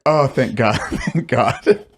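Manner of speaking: relieved, laughing through it; laughing relief. Retold or imitated.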